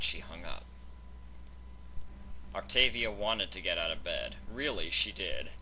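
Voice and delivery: ordinary delivery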